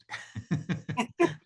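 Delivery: a bright, high burst of laughter; short laugh. Real, not acted. Host laughing at the guest's teasing about her burning question. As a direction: laugh along with the guest's joke.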